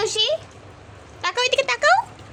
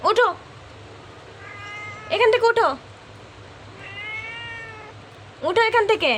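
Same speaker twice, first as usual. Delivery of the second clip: coaxing command; 6 semitones higher, 4 dB quieter than usual. Acted. Owner tells the cat to get out of the basket, higher and coaxing. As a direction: shoo the cat up, half playful.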